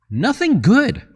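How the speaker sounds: high sardonic nothing good; dry verdict on the market; wry. Real, not acted. Answers own market question.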